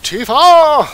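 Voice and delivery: high, loud shout of a name; alarmed cry. Acted. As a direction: cry out for a fallen friend. Calling out to injured friend.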